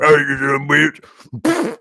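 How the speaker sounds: high, drawn-out strained holler; angry farmer. Acted. Farmer comes out to curse the crashed driver, high mock yell. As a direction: holler like a farmer across his field.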